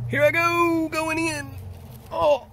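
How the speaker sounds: high, distant voice inside a cave; cave echo. Real, not acted. Crawling into small cave.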